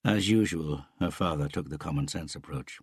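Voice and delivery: ordinary delivery, nothing marked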